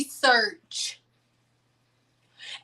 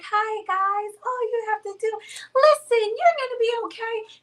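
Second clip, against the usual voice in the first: coddle them like a sweet influencer; sugary influencer voice; 10 semitones higher than usual. Shown as a demonstration. Vlogger mocking soothing influencers, high sugary voice. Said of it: "when I'm talking to you like".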